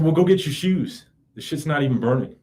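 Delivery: high, impatient retort; fed-up order to a barefoot partner; exasperated telling-off. Retold or imitated. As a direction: snap at him to fetch his shoes. Telling barefoot partner to fetch shoes.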